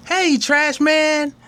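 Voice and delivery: a hint of a little girl. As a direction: greet him like a sweet seven-year-old. Susie voiced, child.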